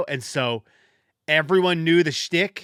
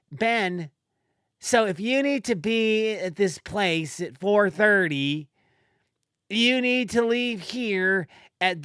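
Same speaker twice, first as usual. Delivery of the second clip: higher, slow, over-explaining mock voice; dumb-me impersonation; 6 semitones higher, slower than usual. Telling a grown coworker when to leave, self-mocking dumb voice. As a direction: spell it out slowly and high, as if he can't follow. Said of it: "impersonation of me being dumb".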